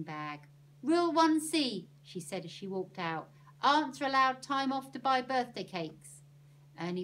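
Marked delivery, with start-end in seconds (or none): 0.8-1.9 high, loud official announcement; ringing rule heading; declaring a rule. A narrator's reading. Annie proclaims another rule. 3.6-6.2 high, loud official announcement; ringing rule heading; declaring a rule. A narrator's reading. Annie proclaims another rule.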